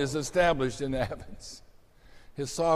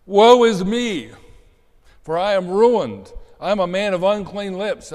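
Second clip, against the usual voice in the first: Isaiah's lament; 6 semitones higher, 8 dB louder than usual. Acted. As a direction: cry out as if undone before God. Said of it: "What does Isaiah say?".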